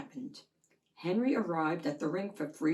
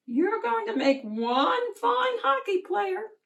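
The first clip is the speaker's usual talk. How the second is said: higher, bright cheering grandma voice; proud grandma; 5 dB louder than usual. Acted. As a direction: cheer him on like a proud granny.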